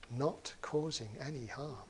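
"not causing any harm" said softly, like an echo inside the head; quiet, inward "not causing any harm"; echoing inner thought. Shown as a demonstration. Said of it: "it resounds around his rather thick skull inside".

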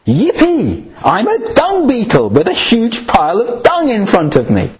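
high, gleeful squeal of delight; gleeful beetle voice. Acted. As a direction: crow it out like a smug beetle. Gleeful dung beetle quote.

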